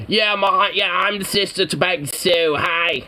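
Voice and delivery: high, put-on Jersey greeting; a hammed-up Jersey sister saying hello; Jersey accent. Invented Angelo introduces herself in a Jersey accent. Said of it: "I don't know why she has that accent".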